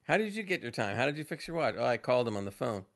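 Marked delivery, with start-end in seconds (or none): none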